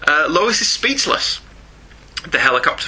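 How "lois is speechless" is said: normal talking voice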